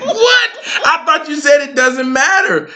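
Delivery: a high, incredulous "What?" that settles back; retelling his shock, half joking; mock disbelief. Retold dinner choice exasperation.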